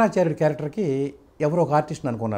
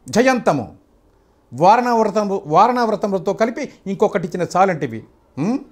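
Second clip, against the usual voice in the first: NTR Duryodhana dialogue; 3 semitones higher than usual. Shown as a demonstration. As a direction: proclaim it as the proud emperor before the court. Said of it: "Ramarao గారిది దానవీర స్వర్గంలో dialogue చెప్తాను".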